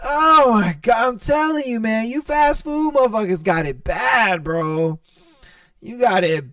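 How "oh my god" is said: laughing exclamation. Real, not acted. Exasperated outburst.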